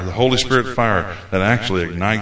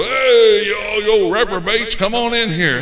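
high, loud mock hollering; a hammed-up street preacher's shout; mock street-corner call; 10 semitones higher, 3 dB louder than usual. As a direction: call out to the street like a hype man. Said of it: "go stand on the street corner and go".

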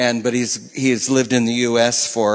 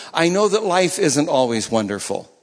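ordinary delivery

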